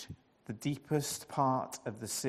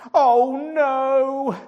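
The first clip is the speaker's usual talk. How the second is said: high, loud wailing groan; 11 semitones higher, 13 dB louder than usual. A narrator's reading. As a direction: moan in dismay. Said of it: "Jonah groaned".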